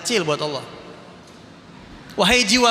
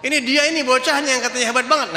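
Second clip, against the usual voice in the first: high, raised cry of a pleading prophet; Musa crying out; 6 semitones higher than usual. Retold or imitated. Preacher voices Prophet Musa crying out to Allah, high raised plea. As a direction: call out to God boldly, pitched high. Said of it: "teriak-teriak".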